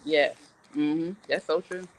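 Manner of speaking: ordinary delivery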